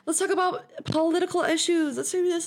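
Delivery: high mocking voice of a preachy drunk; high mock of a drunk guest starting a debate; mock party bore. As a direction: parody an earnest drunk raising politics. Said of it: "I'm not coming in like".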